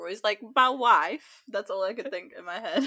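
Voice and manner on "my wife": Borat voice. Retold or imitated. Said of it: "but you just did that".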